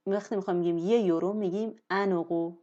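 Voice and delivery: ordinary delivery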